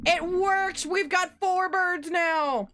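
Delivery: high, loud and thrilled; excited. Real, not acted. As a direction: cheer that it worked, drawn out. Bird trick finally works.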